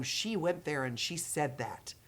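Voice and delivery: hushed reaction. Retold or imitated. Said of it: "They'll either have the reaction of".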